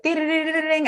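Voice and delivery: high, rapid run of dings; imitated notification dings. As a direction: mimic a phone pinging nonstop. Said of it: "my D Ms would always be like".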